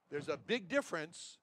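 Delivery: usual speaking voice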